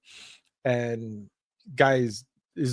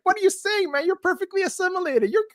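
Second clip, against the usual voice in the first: far higher teasing squeal; mock high-pitched reply. Teasing his friend at a Western karaoke bar, high mock voice. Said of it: "And I'm like".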